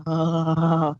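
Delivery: long drooling groan of delight; drawn-out "oh" over imagined sweet food; Homer Simpson. Retold or imitated. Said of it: "like Homer Simpson".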